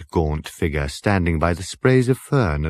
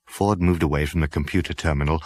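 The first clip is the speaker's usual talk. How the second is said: ordinary delivery, nothing marked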